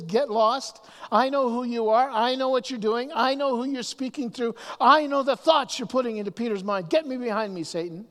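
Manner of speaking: preacher voicing Jesus telling Satan off; Jesus confronting the devil. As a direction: drive the devil off with every line. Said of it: "Jesus confronted the devil right away".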